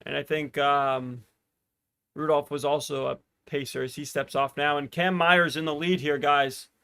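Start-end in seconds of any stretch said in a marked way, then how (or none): none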